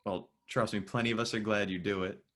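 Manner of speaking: ordinary delivery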